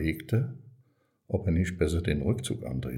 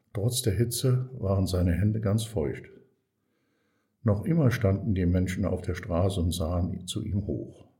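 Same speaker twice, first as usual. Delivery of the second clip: ordinary delivery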